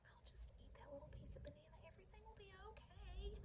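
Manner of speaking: soft, high, rising self-persuasion; little girl ghost reassuring herself. Acted. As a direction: quietly talk yourself into a naughty bite.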